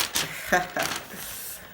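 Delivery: brief self-conscious chuckle; short laugh. Real, not acted. Vlogger about to read English to viewers, short laugh. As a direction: chuckle briefly at your own attempt.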